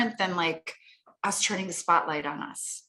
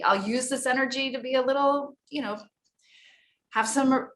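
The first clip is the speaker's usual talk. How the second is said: ordinary talk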